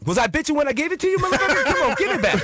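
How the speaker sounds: high-pitched, fast complaint; worked-up retold rant at a freeloader; indignant demand. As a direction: squawk it in comic outrage. Retold money quarrel with roommate.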